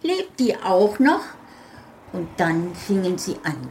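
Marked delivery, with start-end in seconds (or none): 0.0-1.2 high, louder delighted question; cheerful little folk. Acted. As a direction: ask gleefully in a tiny voice. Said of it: "riefen sie fröhlich".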